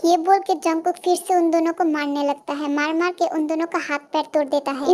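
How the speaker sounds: plain reading voice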